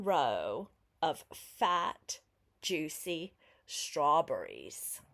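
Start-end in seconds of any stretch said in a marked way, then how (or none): none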